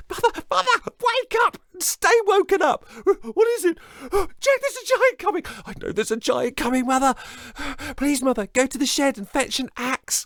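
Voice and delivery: put-on high loud frantic boy; frantic Jack. Jack begging mother to fetch the axe, frantic. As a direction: rush her to fetch the axe.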